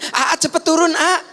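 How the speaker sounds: warning the family of danger; urgent shout. Retold or imitated. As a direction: yell for them to hurry down. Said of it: "kalau ada yang membahayakan".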